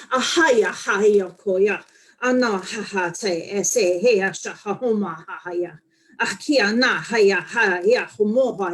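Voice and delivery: loud rhythmic chant of open syllables; channeled light language. Acted. Channeling the spirit Eric for her viewers, loud rhythmic chant. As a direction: pour out strong, flowing ha-ya syllables as if a spirit speaks. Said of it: "see if he's gonna channel some light language".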